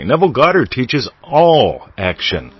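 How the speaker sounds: usual speaking voice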